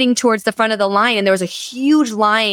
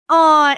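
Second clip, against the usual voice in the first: husband's groan; 6 semitones higher, 4 dB louder than usual. Retold or imitated. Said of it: "my husband's like".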